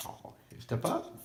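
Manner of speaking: high breathy whisper; whispered coaxing. Real, not acted. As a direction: gently coax the bird in a whisper. Coaxing bird onto hand.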